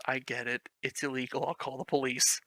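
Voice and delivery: ordinary delivery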